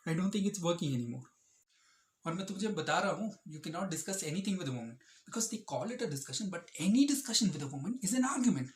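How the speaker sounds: ordinary talk, nothing marked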